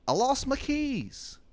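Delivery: very high, slow falsetto; Shithead Steve's voice. Acted. Steve telling Adam about his lost keys, slow high falsetto. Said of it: "And he's like".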